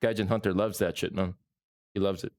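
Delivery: ordinary talk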